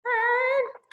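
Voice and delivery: high calling out in the night; calling Mom and Dad. A narrator's reading. Scared boy calling his parents from bed at night. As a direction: call for your parents from bed. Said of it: "It was like".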